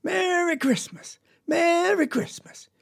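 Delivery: high, drawn-out festive holler; yelling. Acted. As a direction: holler it slowly, stretching the first word. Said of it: "he was a-yelling".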